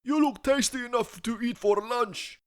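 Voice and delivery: very high put-on voice; mower. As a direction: tease him as a hungry mower.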